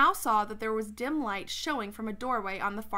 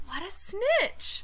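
high, drawn-out disgusted exclamation; indignant aside; 9 semitones higher, much slower than usual. Real, not acted. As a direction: stretch it out in outraged disbelief. Reader exclaims about Edmund.

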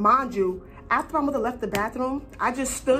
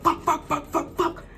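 panicked cursing; 7 semitones higher than usual. Retold or imitated. Panicking as mom comes home.

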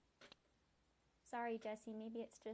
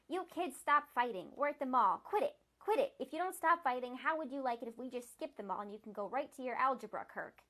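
angry parent yelling; 2 semitones higher, 10 dB louder than usual. Acted. Parent shouting at fighting kids, fed-up yell. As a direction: bark at squabbling children in the car.

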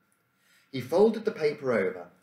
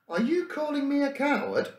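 high, louder indignant challenge; angrily; 4 dB louder than usual. Acted. Trout challenging Emile over being called a coward, high angry demand. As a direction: bristle at the insult, pitched high. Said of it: "Trout asked angrily".

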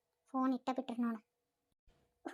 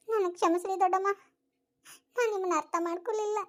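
crying; 5 semitones higher than usual. Acted. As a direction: cry out your sorry through tears. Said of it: "ಅತ್ಕೊಂತ ಕುಂದ್ರದ್ರಲ್ಲಿ ಏನಿಲ್ಲ".

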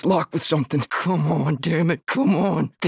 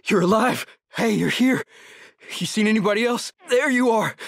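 relieved surprise; 5 semitones higher than usual. Acted. Finds a companion alive.

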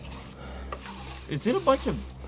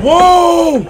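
startled whoa; 10 semitones higher, 8 dB louder than usual. Real, not acted. Startled by game reveal.

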